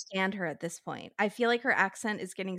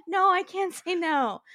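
mock whiny woman; 8 semitones higher than usual. Mocking Lacey's reply.

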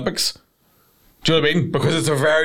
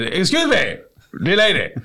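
affected, higher-pitched theatre-goer; pretend arts lover; 5 semitones higher than usual. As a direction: call out like a would-be toff. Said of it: "flung my scarf around and I took my monocle out".

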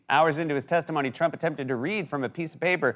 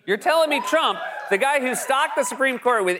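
incredulous; 8 semitones higher than usual. Real, not acted. Incredulous rant continues.

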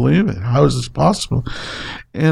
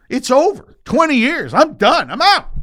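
exasperated outburst; 13 semitones higher than usual. Retold or imitated. Recounting quitting twenty-year career.

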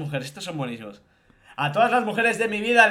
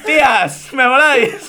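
shouting; 5 semitones higher, 7 dB louder, slower than usual. Acted. Shouted mock homage, laughing.

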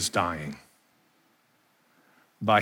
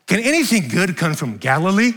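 higher, louder, scoffing question; mock the doubters with a laugh behind it; 8 semitones higher, 6 dB louder than usual. Pastor mimicking scoffers about Galilee, mocking and louder. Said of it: "imagine what they said about Galilee".

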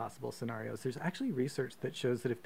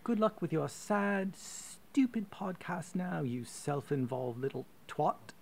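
put-on posh British accent; 6 semitones higher, slower than usual. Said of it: "posh British accent".

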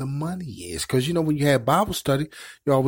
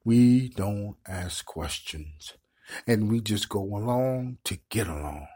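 slow, heavy emphasis; 2 semitones lower than usual. Acted. Preacher slows to stress point.

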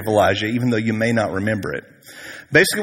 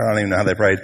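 ordinary talk

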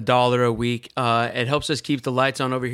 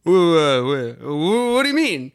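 stuttered, rising bewildered question; a puzzled local asking what the joke means; stammering confusion; 3 semitones higher, 4 dB louder than usual. Retold or imitated. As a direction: trip over the words in confusion. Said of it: "he's like".

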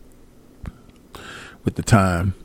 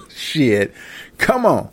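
normal talking voice

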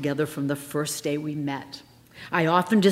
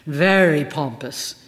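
usual speaking voice

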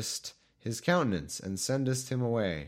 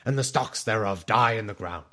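loud, grand wizard reading; hammed-up wizard reading; Gandalf voice; 7 dB louder than usual. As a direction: intone the scripture like Gandalf. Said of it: "I should read it in a Gandalf voice".

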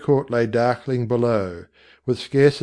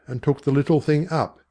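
usual speaking voice

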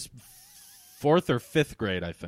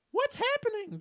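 mock scream. A narrator's reading. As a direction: squeal it out as a screaming girl. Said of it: "the girl screamed".

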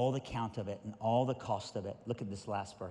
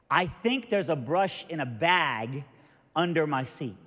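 slow, loud, higher retold voice; loud old man; 5 semitones higher, 9 dB louder than usual. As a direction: answer slowly and loudly like an old man. Deaf old man answers loudly.

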